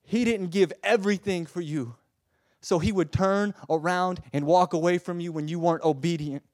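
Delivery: loud, high impassioned preaching; full-force preaching. Acted. Preacher to congregation, loud impassioned preaching. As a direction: plead with fire.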